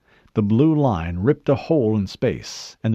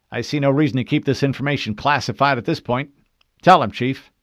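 higher-pitched officer voice, louder at the end; authoritative lieutenant; 5 semitones higher, 4 dB louder than usual. Acted. Lieutenant ordering the Chief to reveal secrets, brisk authority. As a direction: give permission, then the order.

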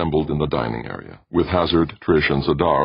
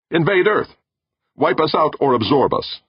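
high, loud warning; alarmed outburst; 13 semitones higher, 5 dB louder than usual. A narrator's reading. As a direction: fire back with raised voice. Heated argument over alien motives.

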